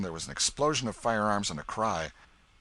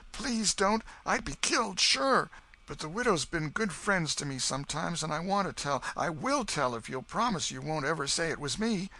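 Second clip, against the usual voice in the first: put-on a frightened boy begging for secrecy; scared boy Huck; 10 semitones higher, 3 dB louder than usual. Huck begs the old man to keep his name secret, high and scared.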